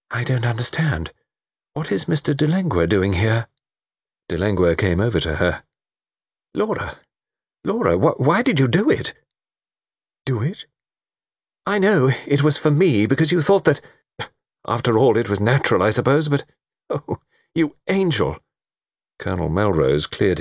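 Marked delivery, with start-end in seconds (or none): 0.1-3.5 higher, puzzled lady's voice; lightly voiced bewildered woman. Laura, startled. 6.5-9.1 high, halting, emotional plea; anguished lover. Acted. Lover confronts Laura, overcome. 10.3-18.4 high, halting, emotional outpouring; adoring man lost for words; overcome lover. Acted.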